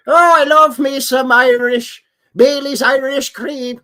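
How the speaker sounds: high, slow, loud hammed-up Irish accent; terrible Irish accent. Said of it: "That's a terrible Irish accent".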